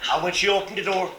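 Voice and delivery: ordinary delivery, nothing marked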